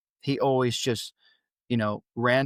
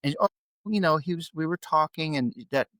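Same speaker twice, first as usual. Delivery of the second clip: ordinary talk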